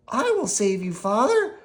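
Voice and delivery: put-on high, brave puppet-boy cry; Pinocchio calling. Pinocchio calling to his trapped father, high and brave. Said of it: "called Pinocchio".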